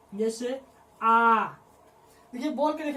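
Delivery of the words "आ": आ stretched long and loud; long vowel demo. Shown as a demonstration. Teacher stretching आ so students hear its length. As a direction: hold आ for a full two beats. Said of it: "जैसे example में लिख लीजिए".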